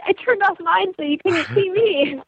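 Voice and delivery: playful comeback. Retold or imitated. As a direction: toss it back at him with a grin. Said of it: "And I was like".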